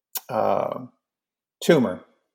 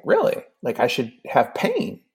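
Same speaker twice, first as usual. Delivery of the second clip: incredulous, the voice jumping up at the news; surprised. Retold or imitated. Told he has a kidney stone, he squeaks back in surprise.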